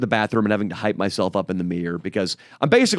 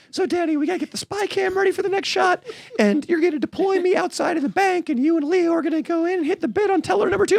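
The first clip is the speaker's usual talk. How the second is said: much higher mocking voice of a friend; whiny high imitation of Nico, faster at the end; faster than usual. Imitating his friend Nico to Danny, in a much higher mocking voice. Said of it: "Nico was like".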